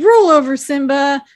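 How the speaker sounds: retold lion-tamer shout; imitating Shaggy commanding. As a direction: order the lion about, hammed up. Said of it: "he's like".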